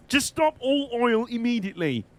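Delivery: high, slow, drawn-out demand; mock protest demand. Streamer imitating oil protesters making demands, mock insistence. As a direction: mimic an indignant activist's slogan.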